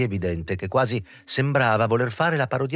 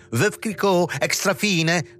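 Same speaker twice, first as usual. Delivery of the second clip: higher, sarcastic, brand name said with refinement; angry cry; 9 semitones higher, much slower than usual. A narrator's reading. Moz jeers at Morenwitz over champagne, angry cry. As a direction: spit out the champagne name in a fury. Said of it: "gridò la Moz".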